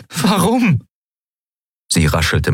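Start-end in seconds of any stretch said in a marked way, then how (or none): 0.1-0.8 high, urgent question; agitated demand. A narrator's reading. Kai confronting Ailan, agitated demand. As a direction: demand the reason, pitch leaping up.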